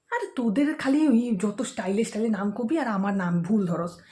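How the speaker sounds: usual speaking voice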